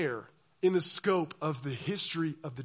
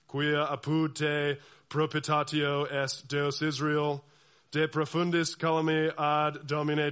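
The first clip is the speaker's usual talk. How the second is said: slow, lower, monotone Latin intoning; droning Latin liturgy; 4 semitones lower, slower than usual. Retold or imitated. Pastor imitating medieval Latin mass, slow droning chant. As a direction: drone it like an old priest at mass. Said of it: "this is what you hear".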